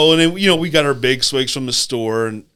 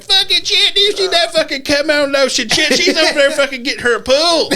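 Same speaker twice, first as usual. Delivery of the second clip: mock trucker on radio; 4 dB louder than usual. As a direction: imitate truckers hollering over the CB.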